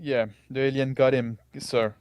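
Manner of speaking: ordinary delivery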